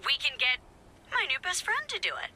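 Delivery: high, overeager volunteering; high-pitched, breezy eagerness; chirpy self-quote. Retold or imitated. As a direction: quote yourself with exaggerated pep. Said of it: "I said".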